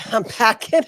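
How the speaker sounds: playful laughing boast. Pretend. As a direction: giggle it like a tough-girl joke. Joking threat, laughing.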